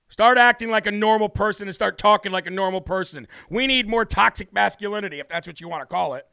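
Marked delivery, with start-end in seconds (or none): none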